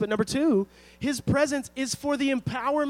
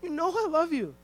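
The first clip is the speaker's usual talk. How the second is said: higher, pleading voice; a touch of anguished pleading; pleading Peter; 6 semitones higher than usual. Retold or imitated. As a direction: insist desperately that you love him. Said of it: "Peter's like".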